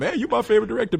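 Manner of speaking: high, gushing fan voice. Retold or imitated. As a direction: gush it like an eager fan. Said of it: "you hear that all the time".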